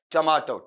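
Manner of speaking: "टमाटो" as tuh-MAA-tau; corrected tomato. Shown as a demonstration. Teacher quizzing students on the result, corrected "tuh-maa-tau". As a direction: say "टमाटो" with long aa and a final au glide. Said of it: "क्या बना आपका वहां पर बताओ?".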